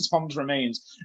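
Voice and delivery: usual speaking voice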